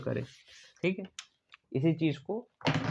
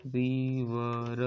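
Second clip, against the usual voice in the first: drawn-out word; much slower than usual. Shown as a demonstration. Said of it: "आर for river".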